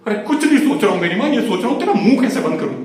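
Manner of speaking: loud, high-pitched fed-up retort; exasperated outburst. Acted. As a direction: explode at her after the repeated question. Snaps after being asked over and over. (तंग आकर ऊँची आवाज़ में झल्लाना)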